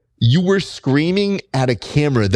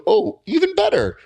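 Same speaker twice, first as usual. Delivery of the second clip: a gleeful higher exclamation; delighted; 10 semitones higher than usual. Retold or imitated. To the fighter, recalling watching his fight: gleeful higher exclamation. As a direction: pitch leaps up, mock thrilled.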